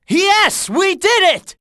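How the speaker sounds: high-pitched victory shout; triumphant cheer. Acted. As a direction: exult at the win. Victory over the tank.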